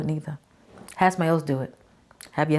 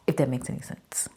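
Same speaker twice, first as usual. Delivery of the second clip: soft whispered aside; whispering; 3 semitones lower, 7 dB quieter than usual. Real, not acted. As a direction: whisper it to yourself.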